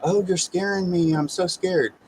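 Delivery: sarcastic imitation of a woman pretending to be scared; mocking scared voice. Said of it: "talking this stupid, this stupid kind of speech".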